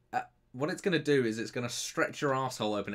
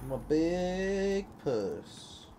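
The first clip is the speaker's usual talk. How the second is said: silly drawn-out voice; 5 semitones higher, much slower than usual. Pretend.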